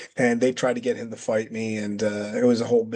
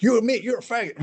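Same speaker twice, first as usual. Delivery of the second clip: higher-pitched Texas taunt; Texas accent; 10 semitones higher than usual. Retold or imitated. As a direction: redo it in a Texas accent, goading. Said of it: "However you, he'd say it".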